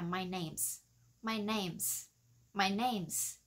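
"names" ending in a hissed s; non-vibrating S. Shown as a demonstration. Teacher drilling the contraction, hissed end of "names". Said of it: "Không có bị rung ở chỗ này".

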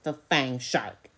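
ordinary delivery

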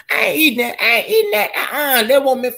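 very high, playful squeal of refusal; mock protest. Streamer jokingly refusing to eat elk, mock protest. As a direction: protest in a high voice, just kidding.

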